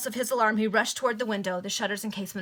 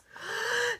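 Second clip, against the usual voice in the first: a high startled gasp of fright; sharp high gasp. Acted. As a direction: gasp as if grabbed from behind. Gasp as Walter is seized.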